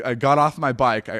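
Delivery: usual speaking voice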